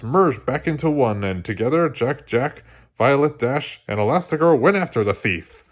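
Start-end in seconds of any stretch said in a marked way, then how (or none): none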